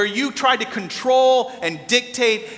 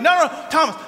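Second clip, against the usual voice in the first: insistent dad; 9 semitones higher, faster than usual. Retold or imitated. Dad overruling his son's objection.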